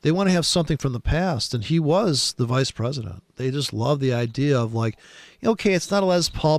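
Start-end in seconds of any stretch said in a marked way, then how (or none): none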